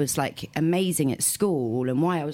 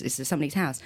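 usual speaking voice

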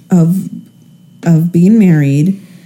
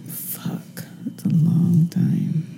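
slow, breathy whisper; 11 dB quieter, much slower than usual. Real, not acted. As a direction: whisper it slowly to yourself. Whispered repeat of long time.

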